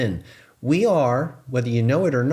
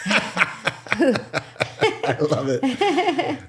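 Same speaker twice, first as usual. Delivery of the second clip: drawn-out, laughing, much higher; 13 semitones higher, much slower than usual. Real, not acted. Reading a funny chat comment, laughing delight. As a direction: let the laugh carry the words slowly.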